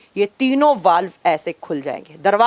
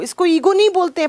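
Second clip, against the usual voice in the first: much higher, sharp rebuke on नहीं; annoyed scolding; 8 semitones higher than usual. Real, not acted. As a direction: hit नहीं hard and high while telling them off. Teacher scolds students over comments. (ऊँची आवाज़ में नहीं पर ज़ोर देकर डाँटना)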